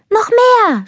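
high, loud cry of delight; excited call. Acted. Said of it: "rief Anna". (hoch und laut vor Begeisterung rufend)

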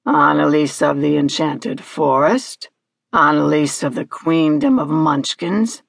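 low, imperious creature voice; deep voice, each item dangled as a question; Hagski. Acted. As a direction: reel off the list, prompting the girl. Hagski lists possible titles impatiently.

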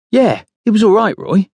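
conceding, high-pitched reassurance; apologetic. A narrator's reading. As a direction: placate him, pitched well up. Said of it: "Nick says with an apologetic glance".

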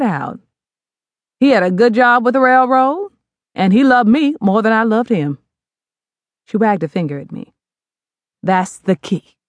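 lightly voiced mother, emphatic; advising mother. Mother advising daughter about men, emphatic. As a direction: pass down hard wisdom. Mother tells her story.

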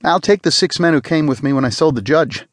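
a cheerful spacer eager to volunteer; said brightly. A narrator's reading. Spacer volunteers for the cutter, brightly upbeat. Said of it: "Rickamer said brightly".

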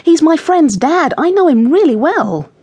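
put-on high, emphatic young girl's defence; indignant, excited insistence; exclaiming. A narrator's reading. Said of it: "Jessie exclaimed".

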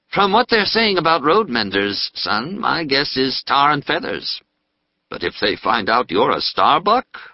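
a fatherly prisoner chatting; portly dentist. Acted. Dentist fears tar and feathers.

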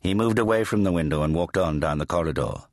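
usual speaking voice

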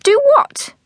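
very high, sharp query; exasperated mother. Acted. Mother baffled by daughter's question, exasperated. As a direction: squawk it in disbelief.

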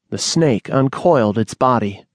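ordinary delivery, nothing marked

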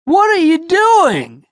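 high, loud complaining call; impatient complaining shout; whiny complaint. A narrator's reading. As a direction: gripe impatiently. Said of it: "Bobby complained".

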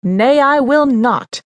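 slow, loud and high defiance; firm refusal. Acted. Mother refusing her son's order to leave, slow and loud. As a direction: plant your feet and refuse.

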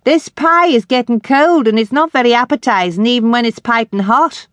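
loud call up the stairs; calling upstairs. Acted. Sadie calling the girls down from the stairwell, loud.

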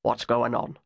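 a hint of a gruff mother; hard older woman. A narrator's reading.

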